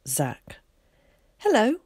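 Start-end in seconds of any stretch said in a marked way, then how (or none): 1.4-1.8 put-on high, loud, bright boyish hello. Boy greeting new friend, bright and eager. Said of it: "he said brightly".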